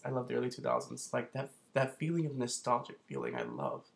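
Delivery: ordinary delivery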